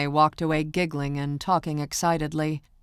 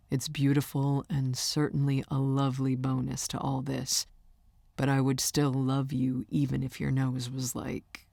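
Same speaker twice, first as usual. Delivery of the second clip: soft, slightly lowered affectionate voice; lightly voiced boyfriend; 3 semitones lower, 4 dB quieter than usual. Singer reassuring his girlfriend about her looks, lightly voiced and soft.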